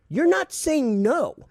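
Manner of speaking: high, slow, drawn-out suspicion; incredulous accusation. Retold or imitated. He catches the caller dodging his question, incredulous. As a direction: stretch it out in high disbelief.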